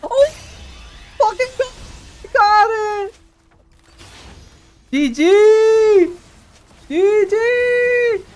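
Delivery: high, loud victory yells; elated gamer screaming; triumphant shouting. Real, not acted. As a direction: yell it out high in short bursts of celebration. Celebrating win in game.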